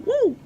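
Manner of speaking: high whooping "woo" to blow off steam; venting frustration in one high whoop; excited woo. Real, not acted. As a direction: let out a sharp high woo. Venting about hospital frustration.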